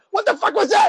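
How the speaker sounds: high, sudden startled outburst; retold startled shout. Moviegoer retelling a scare from right behind, startled shout. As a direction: re-enact a jump-scare yell, pitch shooting up. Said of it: "it scared the shit out of me".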